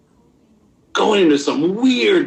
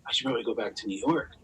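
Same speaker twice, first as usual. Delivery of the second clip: inner thought; 3 semitones lower, 11 dB quieter, much faster than usual. Retold or imitated. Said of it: "I'm thinking".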